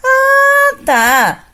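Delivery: high, loud surprised ah then tá. Shown as a demonstration. Teacher demos head voice reacting to news, high ah. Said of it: "e o outro".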